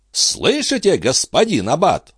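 high exclamation, easing down; crying out. A narrator's reading. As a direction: cry out high on the first word. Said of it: "вскричал Био".

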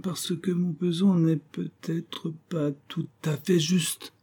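slow, high, halting admission; timid, hesitant owning-up; reluctant confession. Acted. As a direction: drag it out sheepishly, word by word. Isaac haltingly confesses his rigged scale.

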